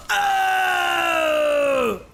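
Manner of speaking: high loud shocked shout; startled cry. Real, not acted. Sudden scare in game.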